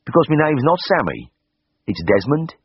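broad Birmingham lad setting the record straight; Birmingham accent. A narrator's reading. Patient tells the vet his real name, in a Birmingham accent. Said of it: "in a ripe Birmingham accent".

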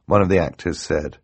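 ordinary delivery

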